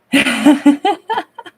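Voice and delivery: high bright burst of delighted laughter. Real, not acted. Streamer laughing with delight at a viewer's sweet comment. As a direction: laugh out loud, thrilled by the comment.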